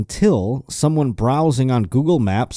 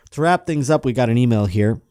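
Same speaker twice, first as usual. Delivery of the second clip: normal talking voice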